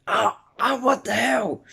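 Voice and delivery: high-pitched mock scream of alarm; alarmed yell. Fan acting Bakugo being thrown, high alarmed yelling. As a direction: voice leaps up in startled protest. Retold fight, character thrown about.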